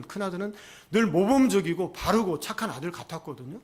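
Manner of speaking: ordinary talk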